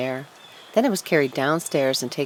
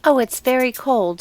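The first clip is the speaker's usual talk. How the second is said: a high, slightly louder mousy voice; little mouse voice; 8 semitones higher, 5 dB louder than usual. A narrator's reading. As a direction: play the small shivering mouse. Little mouse speaks to tree.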